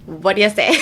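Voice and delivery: "What do you say" as "whaddya say", breaking into a laugh; two-word reduction. Shown as a demonstration. Teacher repeating for a learner, "whaddya say" with a laugh. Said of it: "it's usually, like, two words".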